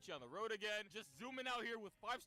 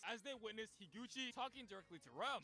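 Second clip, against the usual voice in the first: ordinary talk, nothing marked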